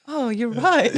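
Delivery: high, bright sudden realisation; mock realisation. Realising her partner is right, in mock surprise. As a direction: act like it just dawned on you. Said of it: "I'm like".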